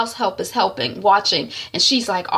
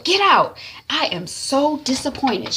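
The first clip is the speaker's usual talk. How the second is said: raised, higher command turning to slow rebuke; stern scolding; 7 semitones higher, slower than usual. Retold or imitated. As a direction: order out, then reproach. Sister dismisses help, scolds sister.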